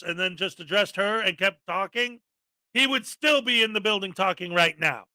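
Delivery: usual speaking voice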